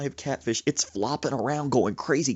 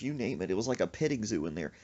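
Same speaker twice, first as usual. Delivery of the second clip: usual speaking voice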